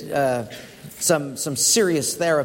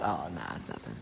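muttered thought; 13 dB quieter, faster than usual. Retold or imitated. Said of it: "I thought".

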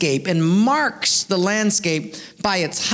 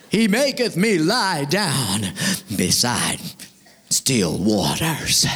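Shakespearean orator; 2 semitones lower, slower than usual. Acted. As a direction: intone it grandly like a stage tragedian. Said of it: "in the Shakespearean fashion".

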